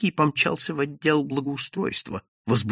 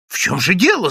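agitated, higher-pitched question; raised, restless demand to know; clamouring; 6 semitones higher, 4 dB louder than usual. A narrator's reading. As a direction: call out in puzzled commotion. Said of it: "загомонили служащие".

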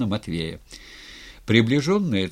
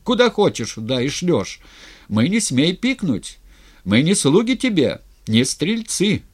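high, loud accusing tirade; shouted protest; 6 semitones higher, 5 dB louder than usual. A narrator's reading. Razin on his knees accusing the tsar, shouting. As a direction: hurl accusations in a raised voice. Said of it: "закричал с колен Разин".

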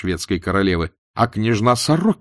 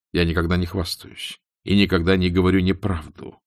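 usual speaking voice

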